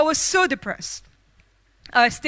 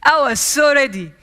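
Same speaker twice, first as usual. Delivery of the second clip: ordinary talk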